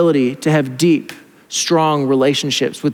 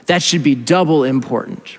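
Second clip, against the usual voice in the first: ordinary delivery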